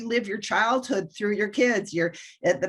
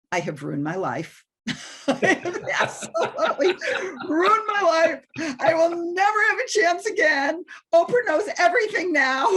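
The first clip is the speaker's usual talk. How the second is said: retold sobbing; 9 semitones higher than usual. Mom retelling her meltdown at the TV, half-laughing sob. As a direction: replay the meltdown as a laughing wail.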